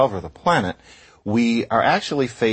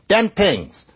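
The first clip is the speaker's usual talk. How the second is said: "Dem tings" with a hard d and t, Brooklyn style; Brooklyn accent; 12 semitones higher, 4 dB louder than usual. Retold or imitated. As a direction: say "Dem tings" like Ralph Cramden, higher and louder. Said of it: "Ralph Cramden on The Honeymooners might say".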